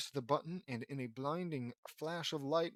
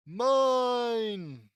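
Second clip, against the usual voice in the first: long, high, loud howl; drawn-out theatrical scream of defeat; villain's final wail; 9 semitones higher, 12 dB louder than usual. Acted. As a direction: wail it out as if vanishing. Villain's final cry as he vanishes.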